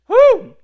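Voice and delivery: short, high, loud woo; exultant whoop. Real, not acted. Preacher fired up after hallelujahs, exultant whoop. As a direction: burst out with a whoop.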